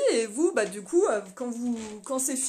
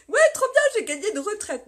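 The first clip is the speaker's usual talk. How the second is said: petit cri aigu et rieur qui imite l'auditrice; enthousiasme moqué; 9 semitones higher, 8 dB louder than usual. Retold or imitated. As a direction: imiter une fan naïve tout excitée. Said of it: "je vous imagine". (s'extasier en riant comme ses abonnées)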